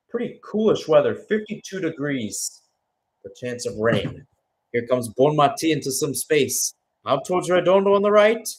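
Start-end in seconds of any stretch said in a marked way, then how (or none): 4.7-8.5 louder, higher call as Spain attacks; live play-by-play. Real, not acted. Commentator calling Bonmati's run, excited play-by-play. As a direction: lift the voice with the attack.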